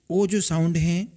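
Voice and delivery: usual speaking voice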